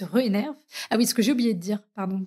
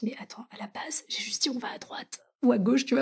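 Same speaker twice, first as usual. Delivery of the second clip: whispered, incredulous protest; whispered disbelief; 2 semitones higher, 6 dB quieter than usual. Retold or imitated. She answers her boyfriend's breakup message, whispered disbelief. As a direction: hush it out, bewildered. Bewildered reply to his breakup text.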